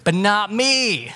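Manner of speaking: mock-proud Peter boast; smug boast. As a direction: brag like you're the exception. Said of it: "classic [name]".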